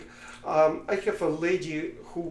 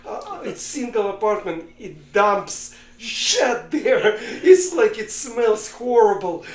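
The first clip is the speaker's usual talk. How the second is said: higher, pleading, hammed-up despair; desperate complaint; 5 semitones higher, 4 dB louder than usual. Poor man begging the rabbi for help with the donkey, mock despair. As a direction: wail it like your home is ruined, lightly played.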